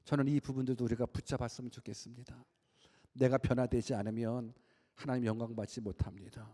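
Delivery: usual speaking voice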